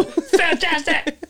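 very high, very slow, stretched out; exaggerated cheer. Shown as a demonstration. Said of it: "Just like that".

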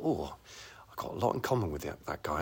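hushed, quick private thought; inner thought whisper. Retold or imitated. Spotting a celebrity at the bar, hushed fast inner voice. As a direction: whisper it to yourself, eager. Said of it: "and I thought".

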